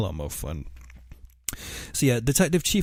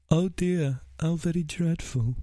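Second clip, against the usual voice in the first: higher, put-on mock-innocent voice; Dennis Nilsen impression; 6 semitones higher than usual. Dennis feigns ignorance to police about remains, mock-innocent dismay. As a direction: play clueless, with hammed-up polite dismay. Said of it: "a man of a thousand accents".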